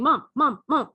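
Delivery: loud, insistent cat voice calling for Mom; a cat demanding its owner come look. Retold or imitated. Cat calling its owner to the mouse, loud and insistent.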